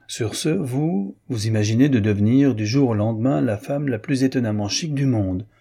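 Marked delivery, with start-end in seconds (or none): none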